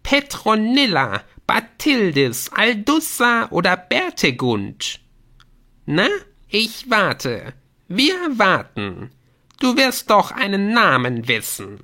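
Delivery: high, loud, stretched gnome voice; jeering gnome. Acted. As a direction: needle them impatiently as a squeaky gnome. Gnome mocks and hurries the player. (spottender, drängelnder Gnom, hoch und gedehnt)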